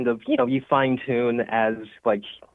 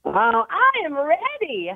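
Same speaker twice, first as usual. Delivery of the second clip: SpongeBob voice; slower than usual. Pretend. SpongeBob actor in character.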